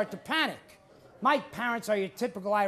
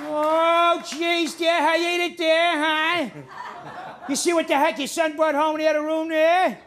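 louder, higher hammed-up drunk dad; Archie Bunker; 5 semitones higher, 5 dB louder than usual. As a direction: play it big, a boozy father aghast at the crowd. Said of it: "drunk Archie Bunker".

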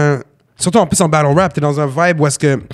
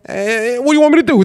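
Paulie from Goodfellas; 7 semitones higher than usual. Retold or imitated. Imitating Paulie again, higher and exasperated. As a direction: throw the question back, exasperated. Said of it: "il répète".

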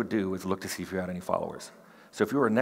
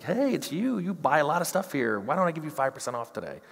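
much higher, bright eager merchant greeting; a shopkeeper who's thrilled you're back; over-the-top happy NPC shopkeeper; friendly shopkeeper; 7 semitones higher than usual. Pretend. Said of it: "they could have really had this guy".

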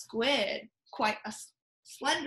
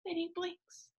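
hushed suspense; 4 semitones higher, 10 dB quieter than usual. A narrator's reading. Suspenseful storytelling hush.

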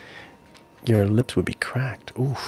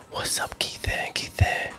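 unvoiced, soft ASMR greeting; ASMR whisper; 8 dB quieter than usual. Real, not acted. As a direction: whisper hello close to the mic.

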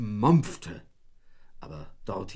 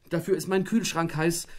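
moaning; 6 semitones higher, 3 dB louder than usual. A narrator's reading. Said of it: "stöhnte Frauchen".

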